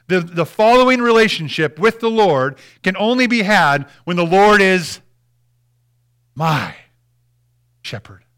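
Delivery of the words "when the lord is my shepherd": "my" set apart by pauses; stress on MY. Shown as a demonstration. Preacher driving home the verse, slow with MY set apart. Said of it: "He's my shepherd".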